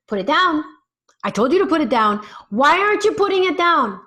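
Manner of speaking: loud, high, rising frustration; exasperated parent yell. Pretend. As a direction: bark the order like a losing-it parent. Parent yelling at child, the mistake.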